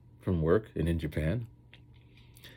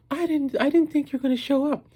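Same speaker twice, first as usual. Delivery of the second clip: high, loud astonished voice; high surprised voice; 5 dB louder than usual. Retold or imitated. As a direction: squeal it in disbelief. Voicing surprised friend.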